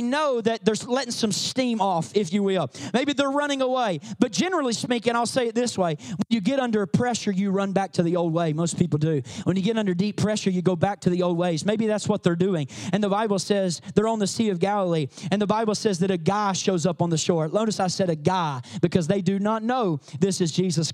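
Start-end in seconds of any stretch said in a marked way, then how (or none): none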